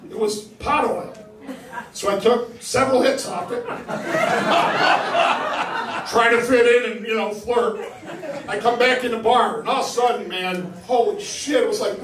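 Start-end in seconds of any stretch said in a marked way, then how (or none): 3.7-6.0 squeaky high-pitched laughter; high wheezing laugh. Real, not acted. Comic laughing about the pot oil, squeaky laugh. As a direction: laugh so hard it goes way up. Laughing after taking hits.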